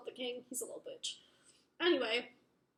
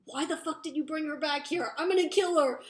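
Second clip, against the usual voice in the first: outraged retold demand rising to a loud threat; acted anger; 3 semitones higher, 5 dB louder, faster than usual. His brother objects to her return, angry acted outburst. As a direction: build from indignation to a loud, higher threat.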